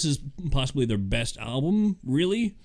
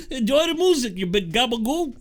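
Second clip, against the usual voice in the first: put-on New York accent; 9 semitones higher than usual. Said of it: "very deep New York accent".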